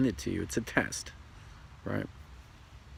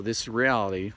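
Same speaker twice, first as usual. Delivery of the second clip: normal talking voice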